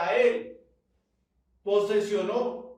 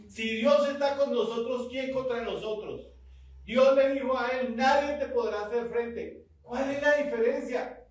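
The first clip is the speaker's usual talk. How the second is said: raised, higher preaching voice; forceful preaching; 6 semitones higher, 4 dB louder than usual. Real, not acted. Preacher challenging the congregation about God's promise, forceful. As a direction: drive the promise home to the congregation.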